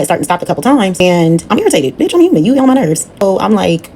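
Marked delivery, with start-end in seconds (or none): 2.0-3.1 sudden high, exasperated burst; exasperated outburst. Real, not acted. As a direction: blow up at her, half laughing. Annoyed outburst aside.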